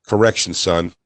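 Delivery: low, grave mentor voice; solemn proud master. Pretend. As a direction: pronounce it like a graduation verdict. Acting master's solemn final pronouncement.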